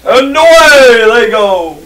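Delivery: loud announcer call. Real, not acted. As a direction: call the start like a commentator. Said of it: "sorry, that was loud".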